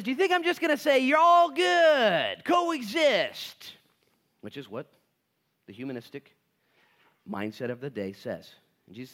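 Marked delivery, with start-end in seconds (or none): 0.0-3.7 very high, loud, slow drawn-out mock; mocking coexist. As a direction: sneer out the coexist slogan. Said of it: "Jesus says".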